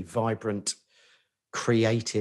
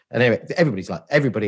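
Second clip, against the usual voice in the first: usual speaking voice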